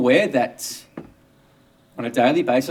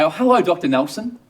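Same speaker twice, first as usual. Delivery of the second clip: lightly higher, friendly hello; a touch of a smile in the voice; lightly voiced greeting mother; 5 semitones higher, 4 dB louder than usual. Mother greeting her doctor in the street, lightly voiced friendly hello. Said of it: "she'll smile at me and say".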